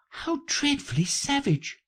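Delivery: high-pitched shocked exclamation; exclaiming. A narrator's reading. Alice hears of the Queen's order, exclaiming in shock. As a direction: react aghast in a high little voice. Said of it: "exclaimed Alice".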